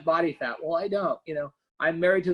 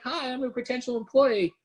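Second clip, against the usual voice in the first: higher, playful mock greeting from a job seeker; imitating an employee; 7 semitones higher than usual. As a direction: pipe up brightly as the new hire. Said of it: "And he's like".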